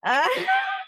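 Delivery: squealing laugh; high burst of laughter. Real, not acted. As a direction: shriek of laughter. Laughing at innuendo.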